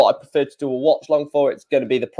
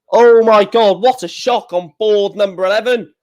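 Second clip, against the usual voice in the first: shocked; 6 semitones higher, 5 dB louder than usual. Real, not acted. Shock result on board eleven.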